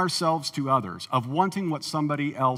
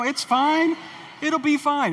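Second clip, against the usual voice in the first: breezy brush-off; 9 semitones higher, slower than usual. Retold or imitated. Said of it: "You know".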